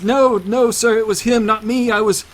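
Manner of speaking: high, loud frantic begging; desperate pleading. Acted. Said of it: "And Henrik pleads".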